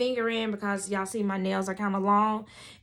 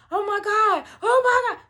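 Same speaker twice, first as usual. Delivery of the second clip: loud, high inner panic, acted; freaking out; 12 semitones higher, 9 dB louder than usual. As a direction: panic louder and higher with each cry. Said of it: "I was totally freaking out".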